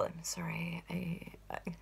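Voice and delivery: very soft, voiceless aside; whispered apology. Real, not acted. Hushed apology mid-remark.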